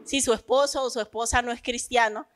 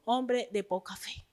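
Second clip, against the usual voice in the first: soft rebuke; 11 dB quieter than usual. Acted. Quotes Jesus rebuking Peter.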